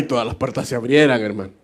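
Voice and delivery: ordinary talk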